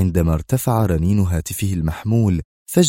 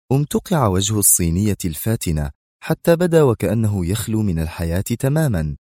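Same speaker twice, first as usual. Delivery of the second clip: plain reading voice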